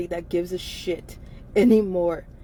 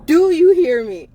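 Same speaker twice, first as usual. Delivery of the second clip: shout; 6 semitones higher, 6 dB louder than usual. Real, not acted. Exasperated demand to listener.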